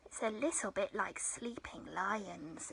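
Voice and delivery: soft unvoiced whisper. Real, not acted. Settling children to be still.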